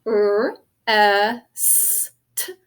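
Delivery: "R, S, T" as held phonemes: rrr, eh-sss, t; phonics-teacher "R, S, T" with gaps; single sounds. Shown as a demonstration. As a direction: sound out "R, S, T" separately, not letter names. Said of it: "what sounds do you hear?".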